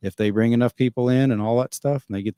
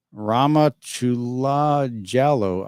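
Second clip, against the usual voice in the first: name sounded out very slowly; real slow; 3 semitones higher, much slower than usual. Real, not acted. Streamer reading a viewer's long name, real slow. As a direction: say the unfamiliar name syllable by syllable, slowly. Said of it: "I had to say it real slow".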